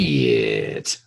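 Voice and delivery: very low, stretched-out swear; drawn-out low curse. Pretend. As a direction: groan the word low and long. Drawn-out comic reaction to the plan.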